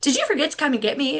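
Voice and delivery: put-on high, quick kid voice, innocently asking; little daughter. Daughter asks mom at the school office, high and bright. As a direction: mimic a cheerful small child. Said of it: "And she's like".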